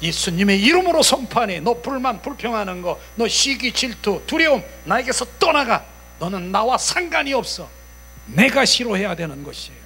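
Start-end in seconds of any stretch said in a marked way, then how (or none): 0.0-7.7 high, commanding preacher voice; forceful casting-out. Acted. Believer renouncing fear and envy, forceful casting-out. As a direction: order the spirits away with authority.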